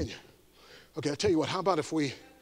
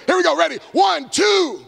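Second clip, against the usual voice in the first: loud Kia; 7 semitones higher, 3 dB louder, much slower than usual. Shown as a demonstration. Preacher shows the crowd the loud karate yell. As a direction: shout the count, pitched high, and bark the Kia. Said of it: "give me a big, loud Kia".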